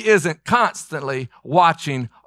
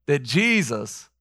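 normal talking voice

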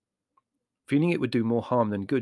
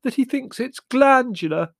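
high, loud, slow tearful wail; high-pitched tearful complaint; 14 semitones higher, 9 dB louder than usual. Acted. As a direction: draw it out in high, tearful distress. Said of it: "Margot confided to us tearfully".

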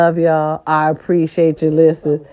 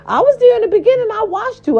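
excited; faster than usual. Real, not acted. Excited praise.

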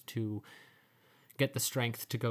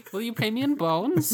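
higher, louder goblin plea; eager little goblin asking; squeaky goblin; 12 semitones higher, 8 dB louder than usual. Retold or imitated. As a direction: goblin bargaining with the harpers. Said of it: "goblin voice continued".

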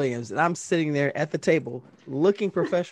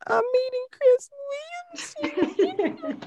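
much higher squeal of disbelief, ending in a laugh; starstruck squeal; slower than usual. Retold or imitated. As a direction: squeal like a fan who's losing it. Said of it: "I lost my mind".

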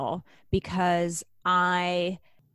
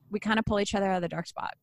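normal talking voice

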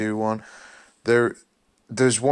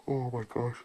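yawning; 3 semitones higher, 8 dB quieter than usual. Real, not acted. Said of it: "really sorry for yawning".

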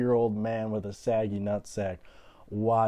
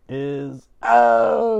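mock surprise; 12 semitones higher, 10 dB louder than usual. Dramatic pause, voice cracks up.

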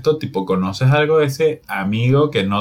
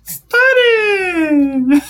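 diva shout. Pretend. Mocking the diva voice.

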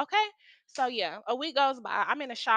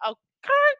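sassy high okay; 5 dB louder than usual. Real, not acted. Sassy aside to the audience.

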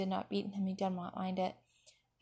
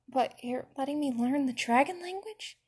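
higher-pitched awed question; 5 semitones higher than usual. A narrator's reading. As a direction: ask in wonder, lifting the pitch. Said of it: "he asked with awe".

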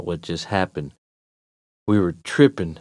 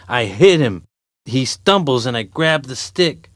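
ordinary delivery, nothing marked

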